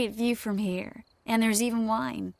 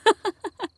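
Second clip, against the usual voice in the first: giggle; 12 semitones higher, 6 dB louder than usual. Acted. Tipsy giggle at the lost guest.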